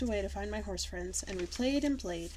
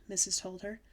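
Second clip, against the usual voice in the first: ordinary delivery, nothing marked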